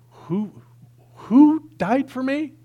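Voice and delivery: high-pitched, drawn-out astonished question; hammed-up bewilderment; incredulous. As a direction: re-enact a teenager's disbelief, loud and slow. Re-enacts his teenage disbelief at gospel.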